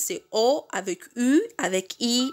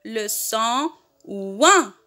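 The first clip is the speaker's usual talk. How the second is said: slow, drawn out for repeating; teacher's slow repeat-after-me delivery; slow model pronunciation; 2 semitones lower, slower than usual. Shown as a demonstration. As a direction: model it slowly, stretching "ouin" with a falling end. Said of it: "Répétez avec moi".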